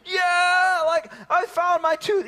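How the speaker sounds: put-on high, loud joyful kid voice; a little girl thrilled to find her tooth; excited child. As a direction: daughter cheering over the found tooth. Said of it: "she was so excited".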